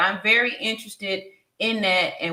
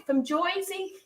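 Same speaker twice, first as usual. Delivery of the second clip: high, playful "Joisey" accent; mock New Jersey accent, pitched up; 9 semitones higher, 5 dB quieter than usual. Shown as a demonstration. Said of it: "My, my Jersey accent".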